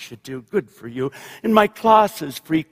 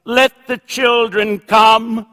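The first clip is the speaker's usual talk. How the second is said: slow, raised, summoning call; mild preacher's shout; 6 semitones higher, 5 dB louder, slower than usual. A narrator's reading. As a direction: call out slowly to the gathering. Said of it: "Then she shouted".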